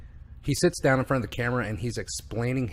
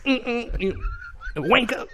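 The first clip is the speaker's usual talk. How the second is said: imitated suppressed tic; 12 semitones higher, slower than usual. Man facing a red-haired cop, imitated tic bursting out. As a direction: try to hold it in, then blurt.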